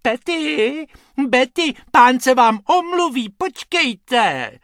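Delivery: very high cooing lady calling pets; high, sugary singsong call; sweet little voice. A narrator's reading. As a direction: coo loudly to your darlings. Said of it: "a sladkým hláskem volá".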